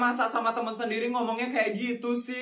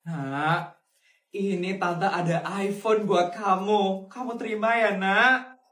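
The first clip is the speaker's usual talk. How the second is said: lower, gentle auntie voice; kind rich aunt; 5 semitones lower, slower than usual. Acted. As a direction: soothe the child like a kind aunt.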